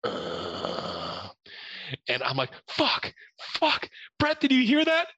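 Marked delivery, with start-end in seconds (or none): 0.0-1.3 low, soft ghostly groan; ethereal male groan. Retold or imitated. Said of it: "this ethereal male groan". 2.7-5.1 much higher, half-cried panic; terrified. Retold or imitated. Hears ghost groan, panics.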